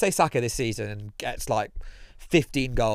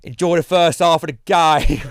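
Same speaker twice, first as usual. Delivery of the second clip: loud, higher sing-song farewell; mock cheery send-off; 5 semitones higher, 8 dB louder than usual. Friends' pre-match ritual, loud cheery mock farewell. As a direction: call it out across the stand like a cheery mate. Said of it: "he go, we go".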